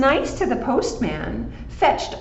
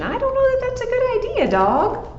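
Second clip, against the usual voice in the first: very high, fast doubtful cat voice; faster than usual. Acted. Cat doubting the dog's plan, high and quick. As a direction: rattle it off high with suspicion.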